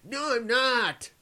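high, slow mock denial; imitating cohost. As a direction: mimic the cohost's droopy denial. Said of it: "You go".

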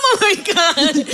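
high giggly oh my God; laughing. Real, not acted. Laughing to the host at her own therapy story. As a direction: laugh it out, helpless.

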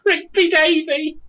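giggling through the words, high and slow; laughing helplessly. Real, not acted. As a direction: crack up while saying it. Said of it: "And I'm like".

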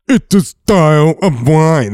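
high, loud, slow mocking copy of his friend; impression of Ian. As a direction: ham up the friend's smug line, slowly. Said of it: "That was a perfect impression".

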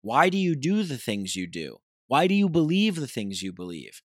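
ordinary talk, nothing marked

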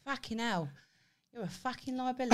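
put-on cockney London grumble; mock London accent. Shown as a demonstration. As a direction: swear like a London geezer.

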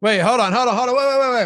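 loud, high, urgent string of repeats; rising excitement, racing to guess; excited interruption. Real, not acted. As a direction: cut in, bursting to get the answer.

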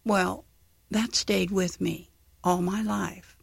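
ordinary talk, nothing marked

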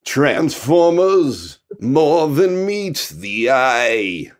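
very slow crooning [name]; [name] impression. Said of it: "He sounds like fucking Neil".

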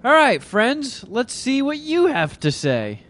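high, slow put-on voice; drawn-out cartoon host; slow high character voice.

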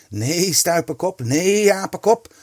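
high, loud, drawn-out cries; crying out. A narrator's reading. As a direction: call out insults, stretching each "nee". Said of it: "riep zij al maar door".

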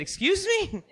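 high, incredulous excuse me; mock shock at God's order; retold astonishment; startled disbelief. Pastor startled by God.

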